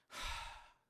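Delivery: re-enacted sigh of discouragement; heavy sigh. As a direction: let out a long, soft, breathy sigh. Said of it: "한숨이 나오더라고요". (낙심한 듯 내쉬는 한숨)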